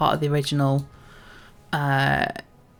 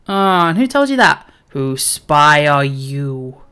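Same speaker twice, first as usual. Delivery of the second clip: louder mock challenge, slowing down; suspicious quest-giver; 2 semitones lower, 5 dB louder, slower than usual. Quest-giver questions a stranger about spying, lightly acted suspicion.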